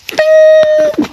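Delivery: high sung ting like a bell; bright vocal chime effect; mock magic chime. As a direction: ring it out like a fairy-tale wish chime. Second wish granted chime.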